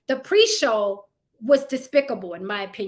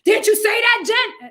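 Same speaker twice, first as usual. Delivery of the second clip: higher, louder accusing voice; mock accuser; 9 semitones higher, 7 dB louder than usual. Critics confronting Jen, higher accusing voice. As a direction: needle her like a gotcha critic. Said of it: "It's like".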